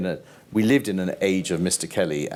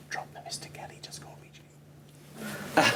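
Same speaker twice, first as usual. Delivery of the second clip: hushed, slow friendly whisper; soft whisper; 5 semitones higher, 9 dB quieter, slower than usual. Retold or imitated. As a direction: lean in and drop the formality quietly. Star quietly relaxing formality.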